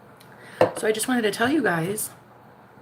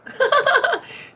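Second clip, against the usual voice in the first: high laughter. Real, not acted.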